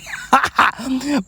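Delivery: high, breath-only chuckle; amused chuckle. Real, not acted. Vlogger amused by a wife's comeback, airy chuckle. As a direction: let out a quick run of airy laughs.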